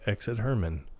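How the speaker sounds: low, soft deadpan; hushed, unhurried cue-calling; bored stage direction. Retold or imitated. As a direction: imitate quietly narrating a routine known by heart. Imitates Phil predicting events, bored.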